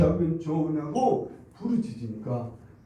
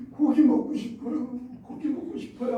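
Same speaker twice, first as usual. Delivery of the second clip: mock whimpering; 6 semitones higher than usual. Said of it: "그러면서 울기까지 하는 거예요".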